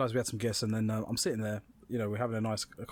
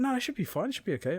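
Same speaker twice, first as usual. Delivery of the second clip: high-pitched, breezy reassurance; lightly mocking, higher voice; imitated guests; 10 semitones higher, faster than usual. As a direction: mimic carefree guests in a lifted voice. Guests' polite reply acted.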